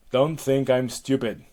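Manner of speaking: loud, forceful warning; imperious rebuke; irritated Hera. Acted. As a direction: warn the nymph sharply. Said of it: "back to Hera".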